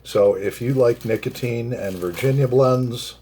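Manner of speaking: usual speaking voice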